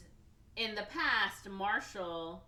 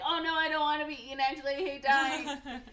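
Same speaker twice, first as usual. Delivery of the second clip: high, loud, rushed dread; acted panic; 8 semitones higher, 8 dB louder than usual. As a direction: wail it in comic panic. Acting Mickey's dread of being eaten.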